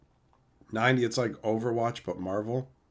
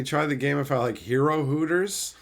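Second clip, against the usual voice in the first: usual speaking voice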